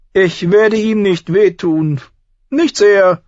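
high, loud, slow prince; prince explaining. A narrator's reading. Prince to Arya with eyes on the boy, explaining loudly. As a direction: declare it slowly, voice pitched high. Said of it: "erklärte Geoffrey Arya".

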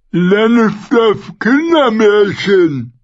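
slow, high and loud sneer; drawn-out mocking scorn; scornful. A narrator's reading. Knight scorns Brienne.

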